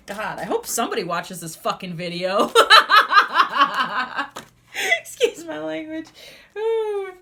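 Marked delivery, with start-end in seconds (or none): 0.1-2.5 laughing. Real, not acted. Laughs at her own swearing. 2.5-4.4 big high burst of laughter; a real, loud high laugh. Real, not acted. Cracks up after rant.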